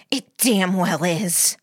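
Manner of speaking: slow, hissed retort; seething hissed comeback; angry hiss. A narrator's reading. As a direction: hiss it with heavy, drawn-out anger. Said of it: "I hissed the words".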